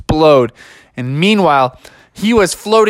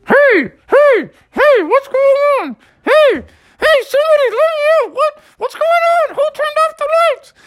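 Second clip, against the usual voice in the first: very high, fast flustered voice; trapped mom. Acted. Mom trapped under the mattress crying out, very high and flustered.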